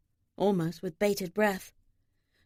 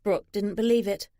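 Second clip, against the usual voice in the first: ordinary delivery, nothing marked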